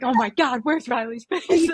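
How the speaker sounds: high mock panic, lightly voiced. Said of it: "I was like".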